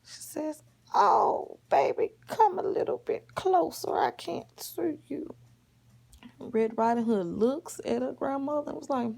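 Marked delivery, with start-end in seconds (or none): 0.9-5.4 high-pitched put-on granny voice; high, sweet-talking disguised wolf; wolf posing as grandma. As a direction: coax the child closer as a fake grandma. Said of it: "The wolf dressed up like her grandma".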